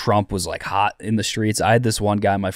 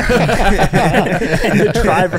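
laughing through the words; bursting into laughter mid-story; 9 semitones higher, 4 dB louder, much slower than usual. Real, not acted.

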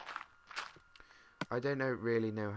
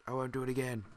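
louder, higher repeat of the promise; emphatic promise; 4 semitones higher, 6 dB louder, faster than usual. Real, not acted. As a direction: insist you mean it this time. Repeated promise after swearing.

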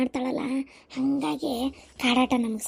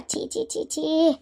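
disgusted tutting; slower than usual. Acted. Mother-in-law scorning the girl's habits, disgusted tuts.

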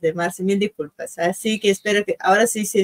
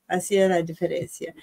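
ordinary delivery

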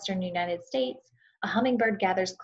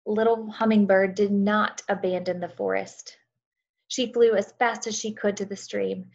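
storytelling narration. A narrator's reading. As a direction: tell it like a bedtime story to a class. Reads story aloud to children.

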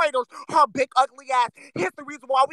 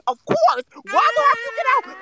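higher, fast indignant mock voice; inner voice; 9 semitones higher, 3 dB louder than usual. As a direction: whine back like someone stuck inside. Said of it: "Your inside thoughts".

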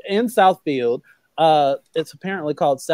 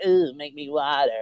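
mock sultry; 3 semitones lower, 7 dB quieter than usual. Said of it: "I'm just imagining being with somebody and be like".

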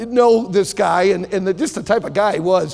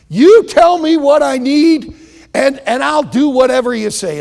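desperate shout; 8 semitones higher, 8 dB louder than usual. Acted. Lester returns desperate to Doctor Law.

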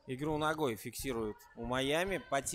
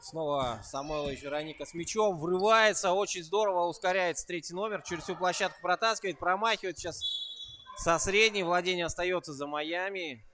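louder, higher commentary riding the attack; live play-by-play; 5 semitones higher, 5 dB louder than usual. Real, not acted. Commentator follows a drive across the court, excited play-by-play. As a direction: call the fast break with rising energy.

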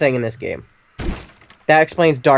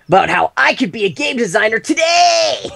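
mock ad announcer; 9 semitones higher than usual. Mocking an ad voice.

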